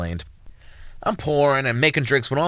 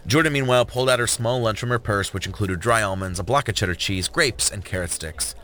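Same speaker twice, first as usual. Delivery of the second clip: ordinary delivery, nothing marked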